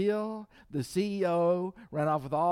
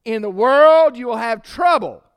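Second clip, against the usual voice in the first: loud, high, driving sermon voice; full-force preaching; 8 semitones higher, 12 dB louder than usual. Acted. Preacher warning the church about bitterness, loud and high. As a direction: preach it hard to the congregation.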